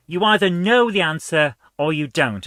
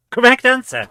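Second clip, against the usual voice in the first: surprised exclamation; 7 semitones higher, 7 dB louder than usual. Real, not acted. Reacting to game result, exclaiming.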